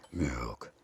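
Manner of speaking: very low, quiet rumble; low voice close to the mic; deep man voice. Retold or imitated. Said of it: "my face was close to the mic and I said".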